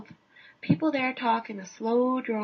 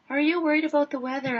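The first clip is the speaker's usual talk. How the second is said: louder, fast, chirpy question; eager reporter; 3 semitones higher, 5 dB louder, faster than usual. Retold or imitated. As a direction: fire it off keen for a quote. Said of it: "I asked".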